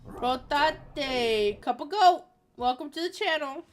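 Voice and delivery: brash mock Brooklyn Aussie; Brooklyn Aussie accent. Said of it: "I was like a Brooklyn Aussie".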